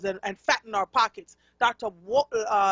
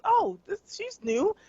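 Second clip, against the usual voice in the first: imitating him; 10 semitones higher than usual. Retelling Lomax greeting her.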